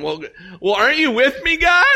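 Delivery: high, loud pleading cry to God; panicked Elijah. Acted. Elijah on the run calls out to God, high and pleading. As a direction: beg God to stay with you, voice cracking up high. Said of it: "you're Elijah, you know, you're going".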